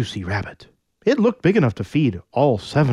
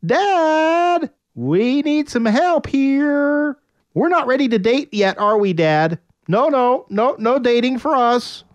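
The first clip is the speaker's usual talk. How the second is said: put-on girls' voice; 12 semitones higher than usual. Daughters voiced pleading.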